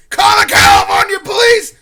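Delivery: high, full-force yell; retold screaming. Stranger screaming at the teen who can't help, frantic yell. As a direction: bellow it like the crazed man. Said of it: "He kept screaming".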